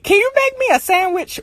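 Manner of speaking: high, wheedling mock parent; mock-sweet high request; imitated parent. Voicing parent asking another favor.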